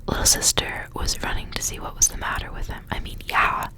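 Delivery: ordinary delivery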